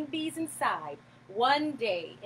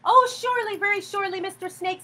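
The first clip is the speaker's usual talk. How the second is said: put-on high, bright-eyed little boy voice, pitched well up and louder; boy coaxing the snake to eat more, pitched high and loud; eager boy; 9 semitones higher, 7 dB louder, faster than usual. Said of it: "said the boy".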